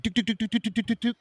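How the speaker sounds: high, quick-fire knock noises; machine-gun tuk-tuk-tuk, pitched high; imitated rapid knocking. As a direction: rattle off a frantic door knock. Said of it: "knocking on, like".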